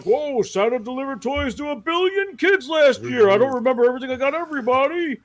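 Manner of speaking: jolly Santa dodging a tricky question. Retold or imitated. Santa answering quizzing girls.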